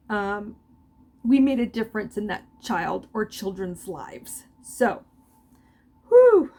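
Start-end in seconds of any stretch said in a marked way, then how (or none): 6.1-6.5 emotional whew. Real, not acted. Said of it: "getting emotional".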